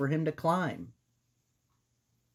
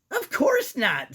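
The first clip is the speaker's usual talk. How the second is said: high squeaky mouse voice; 11 semitones higher, 6 dB louder, slower than usual. Acted. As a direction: squeak it out nervously. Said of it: "in a squeaky voice".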